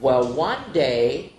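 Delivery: ordinary delivery, nothing marked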